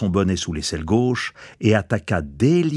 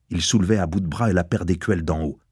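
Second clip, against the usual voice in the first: plain reading voice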